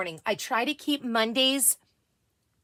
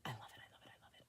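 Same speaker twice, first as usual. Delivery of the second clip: fading murmur; 10 semitones lower, 28 dB quieter, much faster than usual. Real, not acted. Fading repeated I love it.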